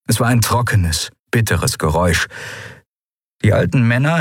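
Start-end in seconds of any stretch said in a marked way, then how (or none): none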